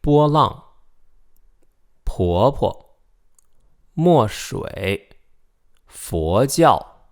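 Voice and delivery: luid en duidelijk, woord voor woord; Mandarin practice words. Shown as a demonstration. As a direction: Mandarijnse oefenwoorden luid voorzeggen. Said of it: "let's do some practice".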